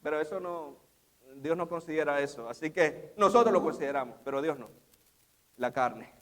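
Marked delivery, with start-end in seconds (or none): none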